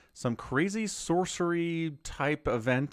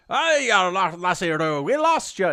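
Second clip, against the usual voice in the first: higher, louder, slow Scottish brogue; Scottish accent; 6 semitones higher, 6 dB louder than usual. Retold or imitated. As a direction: belt it out in a thick Scottish accent. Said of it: "He does, like, this crazy Scottish accent".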